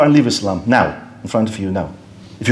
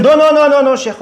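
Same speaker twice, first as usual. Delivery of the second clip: high-pitched hasty objection; mock protest; 10 semitones higher than usual. Humanist refusing to follow communists, high mock protest. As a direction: act out someone backing off, pitch jumping then dropping away. Acting the doubter backing off.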